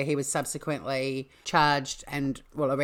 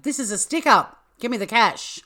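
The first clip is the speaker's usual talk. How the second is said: high, loud robber yell; acted stick-up shout; 7 semitones higher, 6 dB louder than usual. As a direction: bellow the demand like a hammy robber. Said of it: "and shouted".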